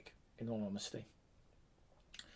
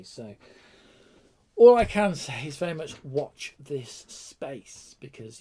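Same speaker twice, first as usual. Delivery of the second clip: ordinary delivery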